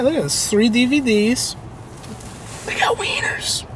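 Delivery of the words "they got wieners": high, breathless whisper; giggly whispered aside. Pretend. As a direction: whisper it like a naughty secret. Whispered joke about wieners.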